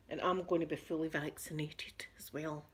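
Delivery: whispered aside, voice dropped to a breath. Real, not acted. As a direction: lean in and whisper it like a secret. Aside whispered after fully vaccinated.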